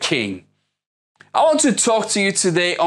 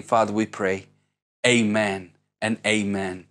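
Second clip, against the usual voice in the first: congregational prayer; 3 semitones lower than usual. Acted. Pastor leading the church in prayer, reverent and hushed. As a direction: pray it humbly over the congregation. Pastor prays before sermon.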